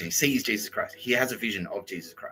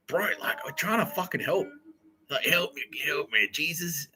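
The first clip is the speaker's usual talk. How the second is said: high, pleading mock whine; whiny plea; 5 semitones higher than usual. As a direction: whine like a helpless guy begging Jesus. Mocking whiny plea in retelling.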